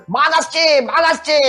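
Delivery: high-pitched cry of false alarm; চিৎকার. A narrator's reading. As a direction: call out high, as the shepherd boy warning the village. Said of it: "চিৎকার করে বলল". (উঁচু গলায় রাখালের মিথ্যা চিৎকার)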